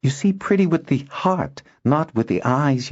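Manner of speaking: put-on insistent girl. Noella corrects Kindon about beauty, insistent. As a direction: lightly play a girl setting someone straight.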